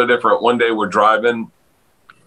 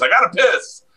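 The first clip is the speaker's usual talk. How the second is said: higher, matter-of-fact; casual bus driver; 8 semitones higher than usual. Retold or imitated. Driver telling Dave why he's leaving the seat, matter-of-fact. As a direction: toss it off like it's nothing. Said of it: "He goes".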